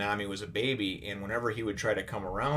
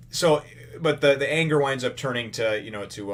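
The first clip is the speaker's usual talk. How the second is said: ordinary talk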